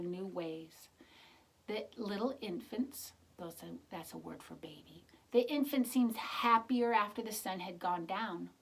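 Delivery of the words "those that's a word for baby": quick hushed explanation; whispered aside. Real, not acted. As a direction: whisper the meaning.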